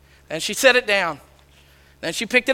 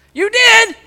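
surprised; 11 semitones higher, 5 dB louder than usual. Retold or imitated. Surprised reply in retold talk.

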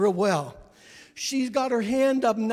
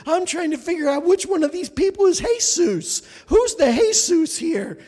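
lightly acted urgent question, higher and louder; insistent searching; 8 semitones higher, 7 dB louder than usual. Woman searching the crowd for Jesus, insistent. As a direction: ask it loudly, trying to find out.

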